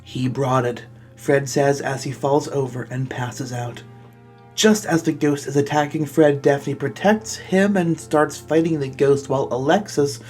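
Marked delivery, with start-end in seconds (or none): none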